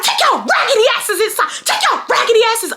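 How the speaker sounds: high, heated mock yell; retold scream. Daughter screaming at sixth graders, high retold yell. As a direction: repeat the order fast and high, as if screaming. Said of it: "I'm screaming".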